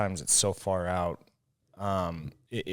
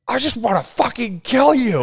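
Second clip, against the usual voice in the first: much higher and louder strangled fury; hammed-up host at breaking point; cartoonish murderous frustration; mock rage; 10 dB louder than usual. Acting out furious host.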